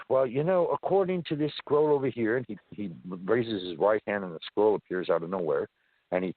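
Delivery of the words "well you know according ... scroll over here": entity's voice. Retold or imitated. Entity voiced in retold story.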